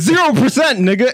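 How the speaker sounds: yelled out high and loud; loud hype shout. Real, not acted. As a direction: belt it out like a hype man. Shouting the poll answer.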